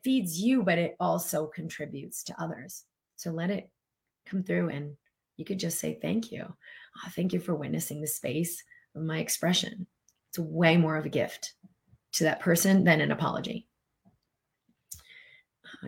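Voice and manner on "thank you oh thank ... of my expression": hushed, tender thanks; soft gratitude. Shown as a demonstration. Showing listeners what to say instead of apologizing, softly grateful. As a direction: drop to a soft, near-whispered thank you.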